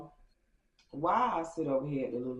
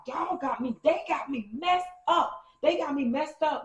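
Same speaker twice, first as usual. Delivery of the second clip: indignant quote; 10 semitones higher than usual. Retold or imitated. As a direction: mimic her outrage, pitched way up. Lulu fuming about baby daddy.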